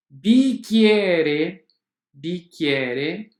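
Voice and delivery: bicchiere said as "bikiere", hard k. Shown as a demonstration. Teacher to students modelling bicchiere with k. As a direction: say bicchiere slowly with a clear k. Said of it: "C H com som de K".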